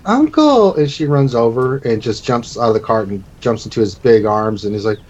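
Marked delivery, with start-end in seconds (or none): 0.1-0.8 high-pitched joyful call; little girl calling out; excited child. Acted. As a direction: squeal it like a reunited child. Child calls out to her uncle.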